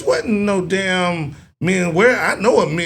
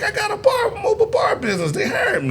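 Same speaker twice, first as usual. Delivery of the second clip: excited friend. Retold or imitated. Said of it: "she was like".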